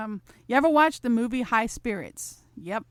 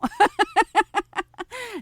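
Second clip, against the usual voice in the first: loud, high burst of laughter; cracking up; 11 semitones higher, 6 dB louder than usual. Real, not acted. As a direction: crack up at your own innuendo. Cracks up at own innuendo.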